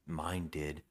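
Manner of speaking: low, quiet imitation of a creep; understated creepy murmur; retold creepy reply. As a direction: drop it down soft and low. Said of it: "it was, like, really creepy".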